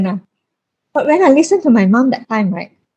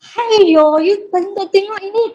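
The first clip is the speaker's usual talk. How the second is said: higher, scolding auntie nurse voice; mock-scolding nurse, pitched up; 8 semitones higher, slower than usual. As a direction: mimic a nagging old nurse clucking at the new mother. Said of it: "And she was like".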